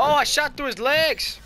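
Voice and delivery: loud, high stretched-out exclamation; excited yell. Real, not acted. Gamer crows over a shot through the legs, excited yell. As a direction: shout in amazement at your own shot.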